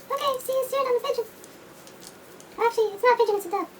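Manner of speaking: high squeaky cat voice correcting; the toy cat way up high, fussing pigeon or dove; Mister Cat. Pretend. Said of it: "Mister Cat".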